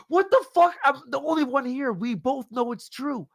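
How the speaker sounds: exasperated mock outburst. Acting out elevator fart quarrel.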